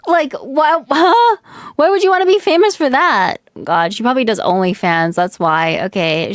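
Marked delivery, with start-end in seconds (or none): 0.1-3.4 how could anyone want that, pitched way up; incredulous disbelief. Real, not acted. Streamer reacts to a clip, high incredulous disbelief.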